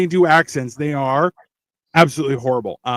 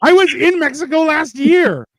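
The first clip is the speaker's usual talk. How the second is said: high, indignant mock-Mexican accent; comic accent drifting into Mexican, pitched way up; put-on accent taken for Mexican; 11 semitones higher than usual. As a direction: protest in a bad Mexican-sounding accent. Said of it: "that's so Mexican".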